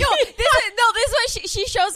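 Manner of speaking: high, loud excited protest; cutting in to tell the story first, excited shout. Real, not acted. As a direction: burst in laughing excitement.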